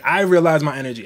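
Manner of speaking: usual speaking voice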